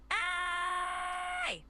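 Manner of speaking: long, high sustained cry; drawn-out emphatic call; held longer. Shown as a demonstration. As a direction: stretch the vowel out high and steady. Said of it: "go a little longer".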